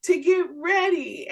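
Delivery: high, insistent imitation of mom; protesting mother. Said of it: "she said".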